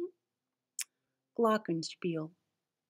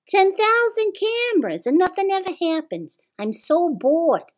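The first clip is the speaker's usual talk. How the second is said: high, whiny bored guard; 10 semitones higher than usual. Acted.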